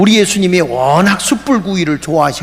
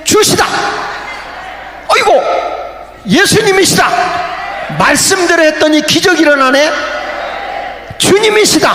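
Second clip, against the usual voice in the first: excited recognition; 10 semitones higher than usual. Acted. Disciple spotting Jesus on the shore, high joyful shout. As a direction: burst out as you recognize the Lord.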